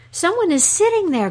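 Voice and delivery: high eager exclamation; amazed dog. Acted. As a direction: blurt it out like a dog caught peeking. Dog peeks again, amazed.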